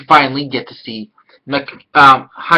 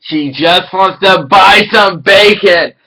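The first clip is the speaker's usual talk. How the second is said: louder and slow, stressing "buy"; emphatic shout; 4 semitones higher, 6 dB louder, slower than usual. Shown as a demonstration. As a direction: hammer the punchline home, leaning on "buy". Repeats line for emphasis.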